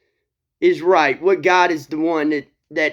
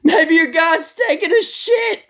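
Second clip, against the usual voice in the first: high loud jeer through laughter; laughing taunt; 3 dB louder than usual. Acted. Elijah mocking the Baal prophets, laughing high. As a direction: crack up while mocking. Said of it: "he's like this".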